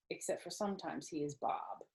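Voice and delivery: usual speaking voice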